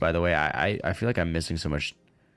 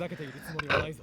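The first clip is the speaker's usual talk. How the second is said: gasp; 7 semitones higher, 8 dB quieter than usual. Acted. Mock gasp at Chunibyo claim.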